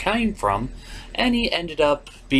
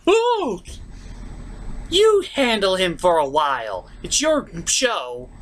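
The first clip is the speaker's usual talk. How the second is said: mock character voice; 6 semitones higher, slower than usual.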